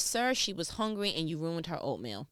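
ordinary talk

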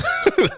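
short high-pitched laugh; quick real burst of high laughter. Real, not acted. Host amused while updating listeners on Jazz, short high laugh.

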